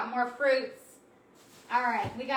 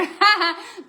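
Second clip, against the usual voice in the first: high, loud laugh; 8 semitones higher, 5 dB louder than usual. Real, not acted. Host amused by her own rapping, real laugh. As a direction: crack up out loud.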